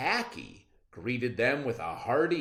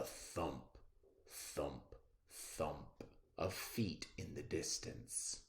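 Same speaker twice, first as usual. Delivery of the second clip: whispered softly, ominous; hushed; 6 semitones lower, 12 dB quieter than usual. A narrator's reading. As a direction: whisper it as danger nears. Distant hunters approaching, hushed.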